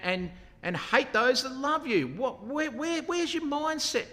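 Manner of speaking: high, heated dressing-down; a commander scolding his king, impassioned; forthright. Retold or imitated. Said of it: "he's pretty forthright".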